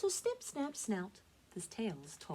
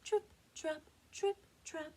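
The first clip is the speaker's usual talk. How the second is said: high, crisp little hoofsteps; light tripping hooves; 11 semitones higher than usual. A narrator's reading. Narrator voices the youngest goat crossing the bridge, light and high. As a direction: tap out each word high and short.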